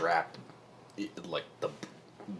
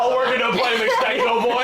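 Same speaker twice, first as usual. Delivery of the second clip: put-on higher, louder, quick old-chap voice; character voice; 12 semitones higher, 10 dB louder, faster than usual. Player hamming up his character to the table, higher and louder. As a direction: play it as a jolly old fellow.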